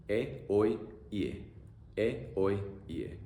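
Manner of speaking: just the vowels e oy ie, slow and separate; teacher isolating vowels; vowel sounds drilled. Shown as a demonstration.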